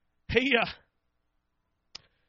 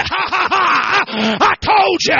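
laughing; 6 semitones higher, 6 dB louder, much slower than usual. Acted. Angel's joyful greeting in sermon.

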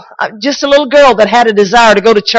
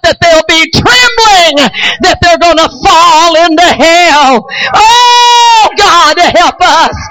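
fiery preaching; 8 semitones higher, slower than usual. Real, not acted. Preacher exhorting the congregation at full force.

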